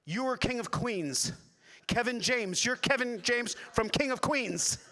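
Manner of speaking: high, loud, accusing shout; imitating an angry fan's announcement; mad fan. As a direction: bellow the recognition as if it's an accusation. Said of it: "like, mad like that".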